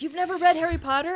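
high, fast disbelief; quick mock-shocked squeak; incredulous quote. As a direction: act shocked he never read it. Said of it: "He was like".

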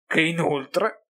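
compiaciuto, più acuto e più forte; smug. A narrator's reading. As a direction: gongola del piano riuscito. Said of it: "con aria compiaciuta". (tono compiaciuto, acuto)

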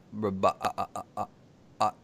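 lightly voiced robot with glitchy, stuttered repeats. Joking to co-host about sounding like a robot, light robot voice. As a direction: play at being a robot, stuck on a syllable. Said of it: "I'm working on my robot voice".

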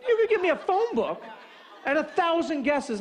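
high-pitched, incredulous objection; exasperated protest. Retold or imitated. Caller faces an impossible question, exasperated protest.

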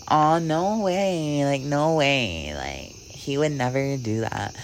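slow, drawn-out disbelieving "no way"; mock naive denial. Her past self denying the shaman's warning, slow and disbelieving. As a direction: play your past self refusing to believe it.

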